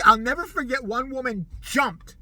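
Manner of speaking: usual speaking voice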